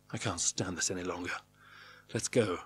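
low, hushed plea; pleading. A narrator's reading. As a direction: beg quietly to leave. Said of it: "pleadingly to Joan".

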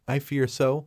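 usual speaking voice

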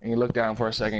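ordinary talk

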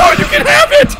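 high, loud panicked shout and laugh; panicked yell. Real, not acted. Player panics at a monster, high panicked shout. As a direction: shriek it away in fright.

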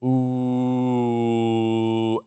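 long steady ooh on one note; held ooh. Shown as a demonstration. Coach demonstrating the roller coaster sound. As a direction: sustain the ooh evenly. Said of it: "like this".

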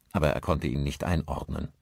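plain reading voice

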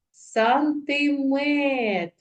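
"Сантиметр" very slow and high, each vowel held; sustained "Сан-ти-метр", no rush on any vowel; drawn-out vowels. Shown as a demonstration. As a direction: read "Сантиметр" in long stretched syllables. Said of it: "протягуючи голосні звуки".

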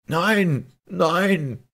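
high, loud wailing denial; howling. A narrator's reading. Police judge confronted by the Caliph, desperate howl. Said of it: "heulte der Polizeirichter".

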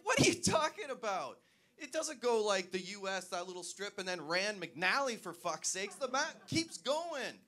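high, loud, incredulous rant; exasperated outburst. Retold or imitated. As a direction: argue in comic disbelief, stretching the last words high. Retold exasperated argument with coworker.

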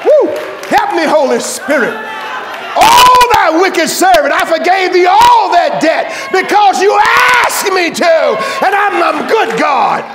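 loud, high whooping preacher in full flight; fiery revival sermon climax; preaching at full force. Acted. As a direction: shout it to the whole congregation.

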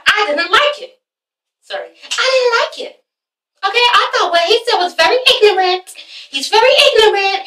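high-pitched, snooty disapproval; imitating a high, dismissive woman; stank-ass attitude. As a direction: give the verdict with haughty attitude. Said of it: "Betty has this stank-ass attitude".

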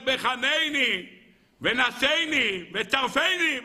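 very slow drawn-out words; slow solemn plea. Acted. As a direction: stretch each word like a prayer. King David quotes the psalm. (תפילה איטית ומתמשכת)

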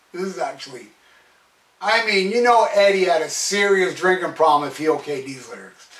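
ordinary talk, nothing marked

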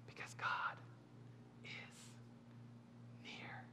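hushed, breathless whisper. Acted. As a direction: lean in and breathe the words. Preacher drops to whisper.

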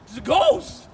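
very high, loud ghost alarm; high-pitched, loud comic cry; high mock-scared voice. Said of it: "in that voice".

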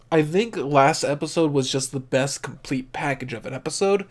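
normal talking voice

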